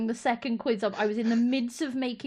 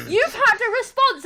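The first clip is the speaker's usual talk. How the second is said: much higher, louder sing-song alert; mock notification voice; 12 semitones higher, 6 dB louder than usual. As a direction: chirp it like a phone alert. Mimicking notification again.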